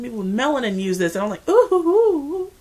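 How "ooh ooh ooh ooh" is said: high, loud delighted oohs; excited oohing. Retold or imitated. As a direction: coo loudly, way up high, acting out delight. Retells delighted reaction to product.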